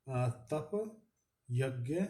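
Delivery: usual speaking voice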